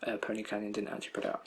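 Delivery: fast, quiet mumbling under the breath. Real, not acted. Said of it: "I'm mumbling".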